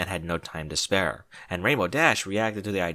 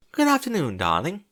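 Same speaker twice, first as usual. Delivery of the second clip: high, bright chirp of greeting; chirpy Rarity; 8 semitones higher than usual. A narrator's reading. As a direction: chirp it happily like a refined lady. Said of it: "she chirped happily".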